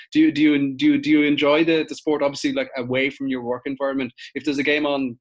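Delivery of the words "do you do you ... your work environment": Galway accent, a touch louder. Shown as a demonstration. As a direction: put on your best Galway accent. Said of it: "I'll say that in my best Galway accent".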